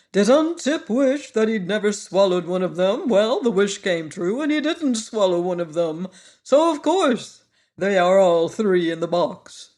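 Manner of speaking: lightly voiced pompous bug; Woggle Bug voice. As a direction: puff up like a know-it-all bug.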